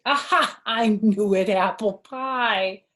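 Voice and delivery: ordinary delivery, nothing marked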